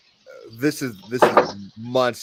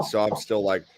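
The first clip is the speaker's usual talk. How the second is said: ordinary talk, nothing marked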